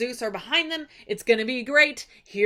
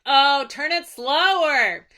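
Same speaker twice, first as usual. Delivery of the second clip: loud, high jeering heckle; hammed-up impatient crowd yelling; taunting suitors; 6 semitones higher, 6 dB louder, slower than usual. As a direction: hurry him along mockingly. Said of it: "they're just taunting him the entire time".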